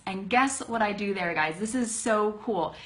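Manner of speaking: ordinary delivery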